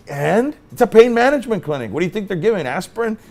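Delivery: high-pitched incredulous comeback; mock incredulous retort. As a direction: toss it back as obvious, pitch jumping up. Lawyer retorts to shocked official.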